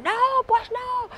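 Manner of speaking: put-on high, drawn-out child's refusal; long, high-pitched childish protest. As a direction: whine it high like a child in a tantrum. Acts out child refusing contact.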